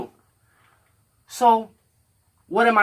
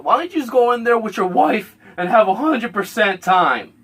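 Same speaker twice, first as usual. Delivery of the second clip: higher, louder mock voice quoting the guy; mocking Richard; 6 semitones higher, 4 dB louder than usual. Quoting Richard's jab about the wife, mocking voice. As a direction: sneer it out in a put-on voice. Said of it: "He said".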